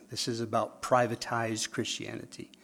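ordinary talk

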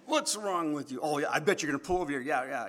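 high, laughing yell at a driver, hammed up; mock road rage. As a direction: play-act shouting at the car that cut you off. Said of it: "and I go".

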